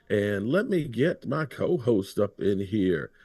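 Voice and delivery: normal talking voice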